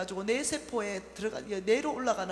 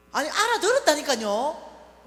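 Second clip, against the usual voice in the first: shouting higher and slower, fed up; exasperated shout; 6 semitones higher, 12 dB louder, slower than usual. Retold or imitated. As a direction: snap back loud at your mother. Arguing with mother, raising voice.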